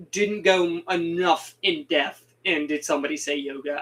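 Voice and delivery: usual speaking voice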